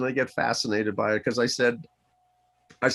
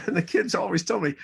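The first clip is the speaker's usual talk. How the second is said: ordinary talk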